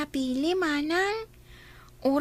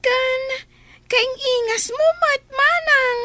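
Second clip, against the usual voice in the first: louder and higher, bubbling with delight; excited compliment; 6 semitones higher, 5 dB louder, slower than usual. Acted. Daughter cheerfully praising the new maid's looks, excited. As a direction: gush the compliment back eagerly.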